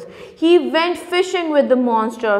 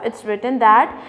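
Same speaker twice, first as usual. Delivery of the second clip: normal talking voice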